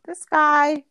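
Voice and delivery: high-pitched incredulous reaction; astonished mom, hammed up; mock disbelief. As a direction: play up the comic bafflement. Mom acts out her disbelief.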